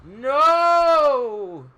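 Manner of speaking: sudden high, loud cry of protest. Acted. Defence cries out as the heavies move in, loud cry. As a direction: yell it to stop them. Dramatic game moment, yelled.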